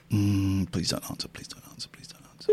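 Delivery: repeated hushed pleading whisper; whispering plea. Real, not acted. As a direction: beg under your breath. Begging nobody picks up.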